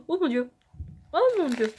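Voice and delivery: gasping at the spicy heat; reacting to a burning mouth; mouth burning from spicy food. Real, not acted. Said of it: "ça pique".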